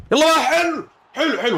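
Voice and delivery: high, urgent cheer; a high burst of joy; excited cheer. Real, not acted. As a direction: urge it on then celebrate. Penalty shootout cheer.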